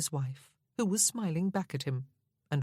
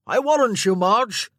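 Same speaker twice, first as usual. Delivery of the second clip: loud, higher hearty roar after his departing wife; 6 semitones higher, 13 dB louder than usual. Acted. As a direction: bellow it across the room. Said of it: "roaring his comment after his retreating spouse".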